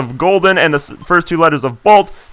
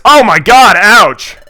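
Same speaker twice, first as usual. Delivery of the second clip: loud and higher, caught off guard; startled yelp; 6 semitones higher, 5 dB louder, much slower than usual. Real, not acted. Let's player jolted when a bully bumps the hero, startled yelp. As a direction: jump at the sudden bump. Bullies knock into them.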